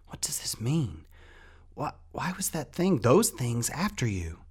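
high, whispery, excited questioning; breathless excitement. A narrator's reading. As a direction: gasp the questions out, pitch leaping up. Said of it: "Rat was out of breath".

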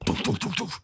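mock knocking thuds; door knock sound. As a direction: imitate fists banging on a door. Imitating banging on door.

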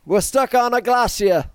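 put-on a quick send-up of Bear Grylls in an English accent; English accent lightly hammed up; mock Bear Grylls. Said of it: "Yeah, Bear Grylls".

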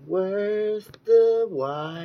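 slow, high, drawn-out emphasis; mock grand. Real, not acted. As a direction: stretch it like a proclamation. Dramatic emphasis.

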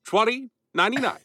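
high, bright Mid-Atlantic announcer; radio announcer. Pretend. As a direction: sell it like an old-timey radio ad. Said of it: "Mid-Atlantic radio announcer".

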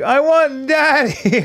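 high, slow drawn-out whine; whiny child. Retold or imitated. Daughter to mom when scolded, slow high whine. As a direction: stretch it like a sulky kid. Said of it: "she's like".

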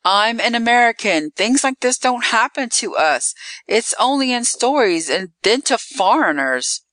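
high, indignant storyteller's outcry; crying out in protest. A narrator's reading. Victim telling Eve this cannot happen, acted protest. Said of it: "I cried".

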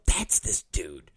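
hushed whisper with no voice. Retold or imitated. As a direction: lean in and whisper the reveal. Confiding punchline about Mike.

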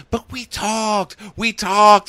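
high, stretched-out whiny imitation; mock pleading. Mocking his pleading.

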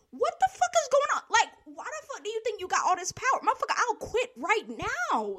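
high, loud, retold tirade; worked-up, incredulous rant; indignant outburst. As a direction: act out telling off a power-tripping boss. Acts out his angry reaction.

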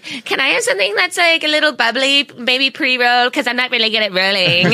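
high, goofy naive tourist. Retold or imitated. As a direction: ask like a clueless giddy tourist. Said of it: "I was like".

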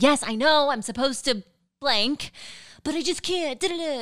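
lightly voiced valley girl whine; a hint of a whiny valley girl, mocking herself; valley girl impression. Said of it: "that's my impression of me".

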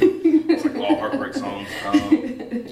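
laughing. Real, not acted. Laughing about heartbreak songs.

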